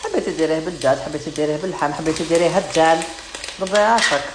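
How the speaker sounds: loud, scornful do-whatever-you-want rant; exasperated outburst. Real, not acted. Mocking viewers who argue recipes.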